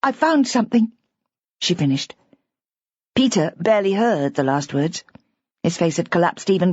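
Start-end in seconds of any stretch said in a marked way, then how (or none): 0.0-0.9 put-on higher, earnest young woman pleading; a young wife trying to reassure him; Bridget, higher and earnest. A narrator's reading. Bridget voiced by narrator.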